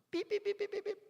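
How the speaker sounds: very high squeaky pi-pi-pi chatter; mocking beeps. To the audience, mocking endless excuses as high squeaky beeps.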